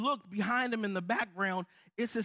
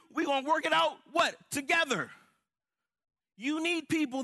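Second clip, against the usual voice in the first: higher, louder preaching climax; full-force preaching; 6 semitones higher, 4 dB louder than usual. Acted. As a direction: drive it home to the congregation.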